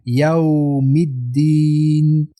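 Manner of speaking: slow chant of یوم الدین with a long final ī; rounded, drawn-out recitation. Shown as a demonstration. Teacher reciting یوم الدین, lips rounded and drawn out.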